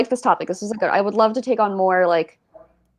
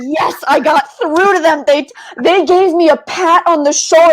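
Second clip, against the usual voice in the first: thrilled; 8 semitones higher, 7 dB louder than usual. Retold or imitated. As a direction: gush like you finally won them over. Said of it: "'Cause you're like".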